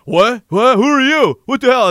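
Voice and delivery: high, loud startled questions; mock bewildered shout. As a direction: imitate confused soldiers crying out. Acting out confused soldiers under attack.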